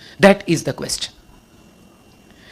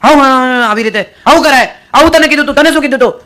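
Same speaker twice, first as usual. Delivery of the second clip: louder, higher imitated outburst; flaring up; 5 semitones higher, 7 dB louder, faster than usual. Restaurant customer berating the waiter, imitated anger. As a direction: scold like an irate diner. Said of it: "waiter ઉપર ભડકે".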